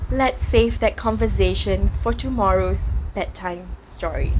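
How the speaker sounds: low, soft fatherly voice; tender, hushed bedtime tone; soft smile. A narrator's reading. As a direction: gently put it off, smiling. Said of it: "and smiled softly".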